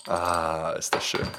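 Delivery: breathy, satisfied sigh after drinking; while sipping a drink. Real, not acted. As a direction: sigh of relief after a sip of beer. Ich muss jetzt hier mit dir einen Augustiner trinken.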